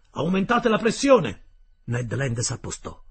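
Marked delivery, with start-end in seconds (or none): 0.1-1.4 high, loud command to push the engines; loud order. Acted. The captain orders more pressure with a loud, high command. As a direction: shout the order down to the engine room. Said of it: "esclamò quindi".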